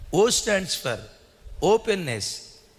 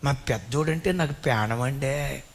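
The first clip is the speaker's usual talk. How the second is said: doting mother; 4 semitones lower than usual. Retold or imitated. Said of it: "తల్లి పబ్లిక్గా చెప్పిద్ది".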